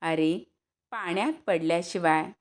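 plain reading voice

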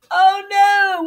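high, loud cry of dismay; alarmed shout. A narrator's reading. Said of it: "Wing shouted".

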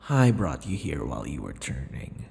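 usual speaking voice

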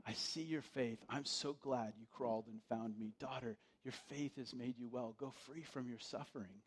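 soft, tender voice of Jesus; gently speaking healing to the woman; compassionate Jesus. Acted. Jesus tenderly addressing the healed woman, soft and compassionate. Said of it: "the compassion in Jesus' voice".

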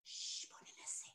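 put-on hushed, breathy singing to the kids; whispered. Shown as a demonstration. Teacher showing kids how to sing softly, whispering. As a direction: whisper the count and the refrain. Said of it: "אפשר לשיר את זה בשקט?".

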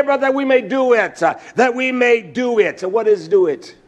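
driving, emphatic sermon delivery; full-force preaching. Real, not acted. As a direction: preach it hard, hammering each repeated phrase. Preaches on a verse.

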